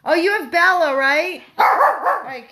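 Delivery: high, loud puppy calling; excited calling. Real, not acted. Calling Zoe the puppy over, excited high voice. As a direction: call the pup over with bubbling excitement.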